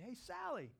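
high-pitched call to someone. Retold or imitated. Pastor hailing a woman on the dock, calling out. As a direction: hail someone across the dock. Calls out to her.

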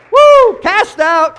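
high, very loud, drawn-out whoop; exultant shout. Real, not acted. Preacher celebrating the ruler's downfall with congregation, exultant shout. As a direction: holler it out in triumph.

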